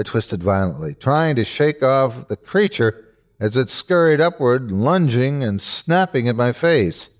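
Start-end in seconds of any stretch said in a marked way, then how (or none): none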